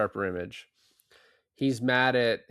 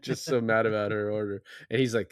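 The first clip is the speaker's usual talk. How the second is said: ordinary talk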